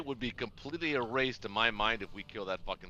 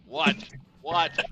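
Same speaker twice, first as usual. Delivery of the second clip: excited; 6 semitones higher, 11 dB louder than usual. Real, not acted. Watching a dice roll in suspense.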